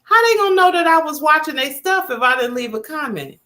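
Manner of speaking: mock panicked inner voice; imitating her own panic. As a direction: act out the sudden worry of a forgotten comment. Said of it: "And I'm like".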